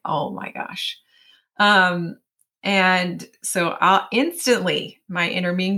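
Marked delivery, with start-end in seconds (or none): 0.0-1.0 breathy unvoiced oh my gosh; hushed realisation of being late; whispered dismay. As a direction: mutter it under your breath in dismay. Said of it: "And I was like".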